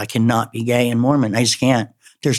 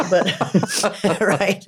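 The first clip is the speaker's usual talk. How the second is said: higher-pitched, laughing through the words; 7 semitones higher than usual. Real, not acted. Speaker joking about giving up coffee, laughing. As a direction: let the laugh break into the words.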